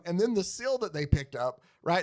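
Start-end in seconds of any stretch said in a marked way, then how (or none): none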